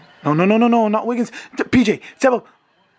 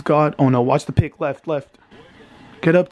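ordinary talk

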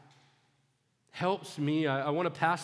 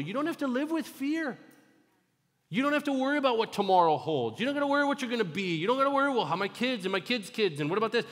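rising passionate sermon delivery; preaching; 5 semitones higher than usual. Acted. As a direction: drive the point home to the congregation.

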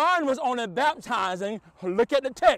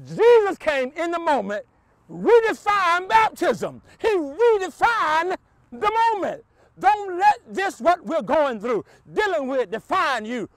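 preaching at full force; 6 semitones higher, 5 dB louder than usual. Acted. Pastor proclaiming to the congregation, full force. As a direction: ring it out to the church.

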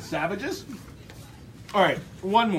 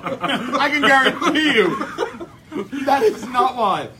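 laughing; 5 semitones higher, 6 dB louder, much slower than usual. Real, not acted. Laughing denial about heart rate.

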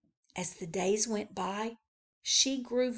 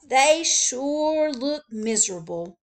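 slow, louder, glum agreement; glum Joe; 5 semitones higher, 6 dB louder than usual. Acted. Joe sighing over the animals, slow and louder. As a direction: lament the sad animals slowly. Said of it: "said Joe".

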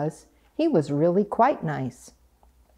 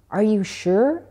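mildly astonished, higher rising question; 4 semitones higher than usual. A narrator's reading. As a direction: ask with a touch of amazement. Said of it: "Duck was astonished".

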